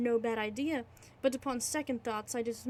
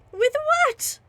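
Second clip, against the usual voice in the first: high, loud shouted question; 13 dB louder than usual. Acted. Narrator shouts at the landlord, loud and high. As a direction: yell it at him. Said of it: "I shouted I".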